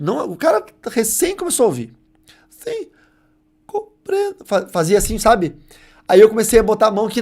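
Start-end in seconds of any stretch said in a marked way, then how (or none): 2.5-4.4 high, thin imitated first "Sí" of a formerly deaf boy; struggling first word. Formerly deaf boy answering the preacher, high and effortful. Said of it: "com toda dificuldade".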